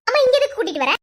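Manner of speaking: loud, high indignant challenge; angry protest. Acted. Wife objecting to him bringing his girlfriend here, indignant. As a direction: object sharply at full voice.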